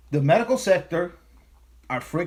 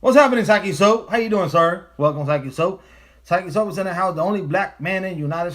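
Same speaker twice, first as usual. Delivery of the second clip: fast, loud, higher greeting to a viewer; loud hype shout-out; 4 semitones higher, 5 dB louder than usual. Real, not acted. Streamer hollering a welcome to Saki Soap in chat, fast and loud. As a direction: hype up the viewer like a radio host.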